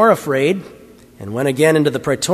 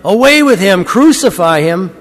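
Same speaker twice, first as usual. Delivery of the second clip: loud, high cries of rejection; crowd crying out; 5 semitones higher, 6 dB louder than usual. A narrator's reading. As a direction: shout each demand like an angry crowd. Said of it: "But they cried out".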